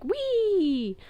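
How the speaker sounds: very high squeaky whee; a toy arrow whistling past; high thin whizz of glee. Retold or imitated. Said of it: "They made whizzy sounds".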